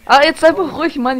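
loud, irritated, shut him up; irritated outburst. Real, not acted. Annoyed at repeated callouts. (genervt und laut)

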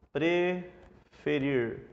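"preferir" split slowly in two, pre... ferir; syllable by syllable. Shown as a demonstration. Teacher sounding out the word for the class, syllable by syllable. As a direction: draw out "preferir" with a break after pre.

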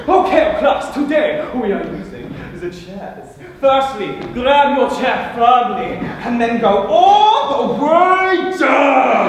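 loud, theatrical dance instructor; dance teacher. Acted. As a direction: call out steps grandly, stretching the last words. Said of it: "the squat pump entered the classroom".

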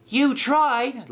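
high, cheeky comeback; laughing retort. Acted. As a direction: pipe it up triumphantly. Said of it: "laughed Skiff".

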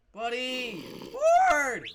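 loud call climbing to a screech; calling out loudly. Real, not acted. Player calling his pet from afar, screeched. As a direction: holler for a lost pet.

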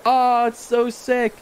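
high, slow mock sales pitch; hyped salesman. Player mocking Nook's pitch, high and slow. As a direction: oversell it like a pushy dealer. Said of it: "he's like".

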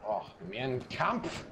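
put-on slow, drawn-out German accent. Joking with teammates mid-game, in a slow German accent. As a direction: savor it in a hammy German accent. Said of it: "The German accent is on point".

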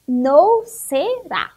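"no será" read slowly, syllable by syllable; scrambled syllables. Shown as a demonstration. Teacher reading jumbled syllables to pupils, "no será" slow and separated. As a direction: sound out NO, SE, RA as separate loud beats. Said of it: "desembaralhar todas essas sílabas".

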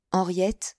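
plain reading voice

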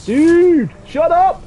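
high, loud, irritated yelling; exasperated shout. Real, not acted. Gamer who cannot concentrate silencing his teammate, exasperated yell. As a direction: shout them down, pitch right up.

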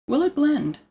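high TV-host "Will it blend?" with "it" stressed. Retold or imitated. Imitating the blender show host for viewers. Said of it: "You guys watch that channel?".

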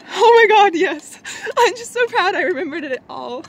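high, loud burst into giddy relief; high-pitched proud excitement; proud and thrilled. Real, not acted. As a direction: exclaim it, thrilled with yourself.

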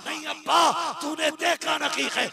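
high, loud cry of protest; protesting son. Acted. Dying son answers his father, a loud high protest.

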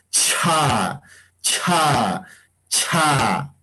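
each "cha" with a long puff of air after the ch; drawn-out, breath-filled ch in every "cha"; aspirated ch. Shown as a demonstration. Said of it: "Vamos a un poquito aspirar".

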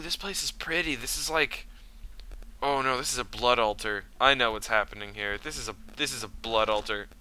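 usual speaking voice

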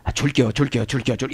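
soft, repetitive mumbled promise; mimicked grudging promise. Preacher mimics how not to promise, soft repetitive mumble. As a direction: mimic someone repeating a promise under their breath. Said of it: "이렇게 하지 말고".

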